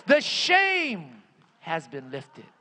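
slow, falling to a low finish; settling repeat. Real, not acted. Preacher closes the climax for the congregation, settling repeat. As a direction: let the declaration come down gently.

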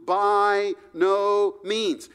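BY NO MEANS, slow and punched word by word; utterly emphatic. Retold or imitated. Preacher voices Paul's refusal to the congregation, utterly emphatic. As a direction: hammer each word of "By no means", drawn out. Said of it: "cannot say it any more emphatically than that".

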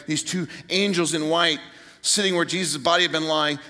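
ordinary talk

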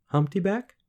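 put-on high questioning girl voice; tentative suggestion. A narrator's reading. Girl guessing the name, tentative. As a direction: venture a guess, lifting high. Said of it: "she suggested".

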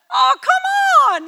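high, loud, laughing protest; exasperated laughing shout. Retold or imitated. Watching Jesus stroll while afraid, loud laughing exasperation. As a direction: yell at him to hurry up, half laughing. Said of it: "and I'm all".

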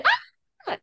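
very high yelp of pain; short, high-pitched mock shriek; pained cry. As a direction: act out a sudden scald. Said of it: "I'm, like, in pain".

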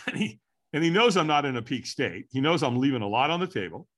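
ordinary delivery

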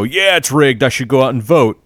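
hammed-up gullible enthusiasm; mock dumb supporter. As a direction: mimic a clueless voter cheering along. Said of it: "they're stupid enough to sit there and go".

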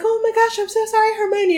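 high, fluttery apology; flustered McGonagall. Retold or imitated. McGonagall apologizing to Hermione, flustered and high. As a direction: gasp out a hurried sorry. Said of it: "And she's like".

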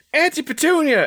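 put-on very high-pitched child's cry; excited little girl. Jenny running in to her aunt, excited little-girl call. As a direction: squeal it out as a kid running in.

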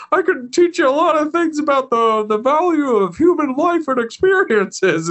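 Jiminy Cricket done as Jimmy Stewart. Retold or imitated. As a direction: stammer politely like Jimmy Stewart. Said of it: "Jimmy Stewart voice".

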